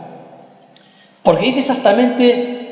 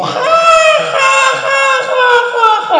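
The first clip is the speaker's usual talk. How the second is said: shrieking laugh; much slower than usual. Acted. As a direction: let out a shrill howl of laughter. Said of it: "Y el tío".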